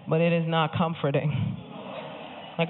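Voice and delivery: ordinary talk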